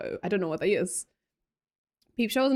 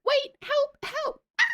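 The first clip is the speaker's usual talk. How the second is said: high-pitched mock cries for help; imitated falling scream; slower than usual. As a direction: imitate a little girl shrieking as she falls. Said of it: "she screams the whole way down".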